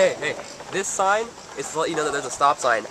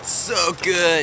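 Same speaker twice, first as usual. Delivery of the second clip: mock dreamy; 4 semitones higher than usual. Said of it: "why we're talking like that".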